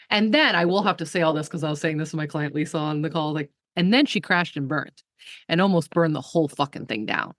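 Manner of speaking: ordinary talk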